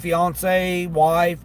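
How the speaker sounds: usual speaking voice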